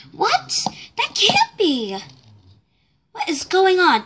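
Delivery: high shocked disbelief. Acted. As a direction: gasp it in disbelief. Shocked at missing money.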